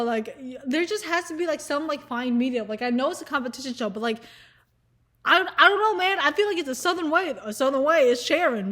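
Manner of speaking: ordinary delivery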